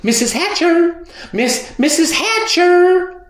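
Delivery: put-on high girl's call, stumbling over the name; girl scrambling down the rocks, calling the mother high. A narrator's reading. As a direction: call after her eagerly, high. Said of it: "Sheila called".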